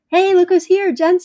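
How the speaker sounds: high, loud happy hello; light up as a friend arrives; delighted welcome to a friend; excited greeting. Real, not acted.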